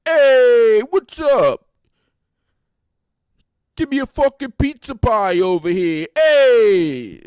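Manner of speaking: loud, high Jersey wise guy voice; shouted mock wise guy; wise guy impression. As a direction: ham up a Jersey Shore tough guy calling out. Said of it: "They ain't even gotta say words".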